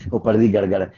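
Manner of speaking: low gibberish reply in character; Bishan Singh answering. Acted. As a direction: grunt the nonsense as a meaningful answer. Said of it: "आपको समझ में आ गया क्या कह रहा है?". (बिशन सिंह का नीचे सुर में जवाब)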